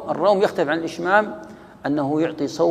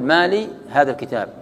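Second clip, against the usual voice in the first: "ما لي" joined, a break, then "هذا الكتاب"; pause after lam; 4 dB louder than usual. Shown as a demonstration. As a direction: halt on maa-li before "هذا الكتاب". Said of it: "منهم من يقف على اللام".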